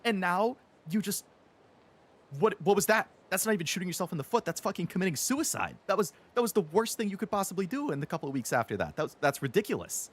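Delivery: high, fast, incredulous outburst at Xbox; exasperated rant. Retold or imitated. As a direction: rant at them in disbelief, speeding up. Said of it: "and I'm like".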